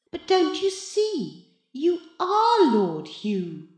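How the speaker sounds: high voice slowing into a drawn-out reveal; smug cat in boy's body. A narrator's reading. The voice tells Maurice of the swap, smugly. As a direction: spell it out slowly, with relish.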